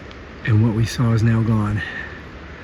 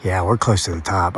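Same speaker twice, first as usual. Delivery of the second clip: normal talking voice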